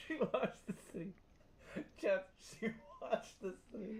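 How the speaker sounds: high-pitched, helpless laughing voice; real laughter pushing the pitch up. Real, not acted. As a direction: squeak it out to the chat mid-laugh. Cracking up, telling the chat.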